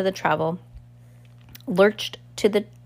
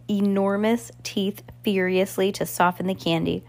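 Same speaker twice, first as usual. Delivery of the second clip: usual speaking voice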